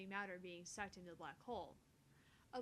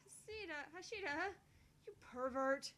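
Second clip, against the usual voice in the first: rising embarrassed outburst, louder and higher; flustered yell; 6 semitones higher, 6 dB louder than usual.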